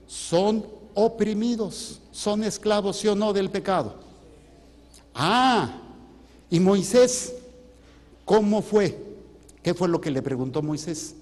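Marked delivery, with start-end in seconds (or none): none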